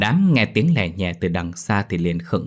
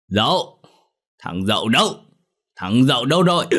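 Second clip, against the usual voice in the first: put-on drunk father yelling; 4 semitones higher than usual. A narrator's reading. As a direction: bellow for the boy, whip in hand. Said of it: "gọi lớn".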